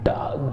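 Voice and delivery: डॉग with an open aw, neither 'daag' nor 'dohg'; correct English vowel. Shown as a demonstration. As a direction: model डॉग with a drawn-out 'aw'. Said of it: "क्या बोलेंगे". (डॉग में खुला ऑ बोलें)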